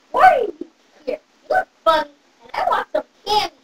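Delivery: ordinary talk, nothing marked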